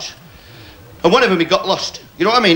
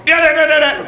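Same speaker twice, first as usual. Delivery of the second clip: machine-gun noise; 10 semitones higher than usual. Retold or imitated. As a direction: rattle off the guns with gusto. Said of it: "He got some bottle".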